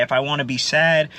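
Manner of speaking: normal talking voice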